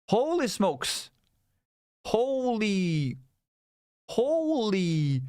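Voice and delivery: drawn-out, high holy smokes; amazed. Real, not acted. Showing viewers a tiny gap, slowly and highly amazed. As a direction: stretch each holy in mock astonishment.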